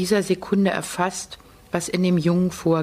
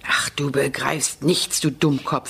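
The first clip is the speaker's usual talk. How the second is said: lower, slowed, bitter scolding; a touch of grim anger; angry; 4 semitones lower than usual. A narrator's reading. Janusz scolding the little boy, bitter. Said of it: "sagt Janusz böse".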